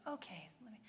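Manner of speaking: barely audible, resigned hush; weary bedtime whisper. Retold or imitated. Worn-out mother to son at bedtime, whispered resignation. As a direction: whisper it, nearly no voice.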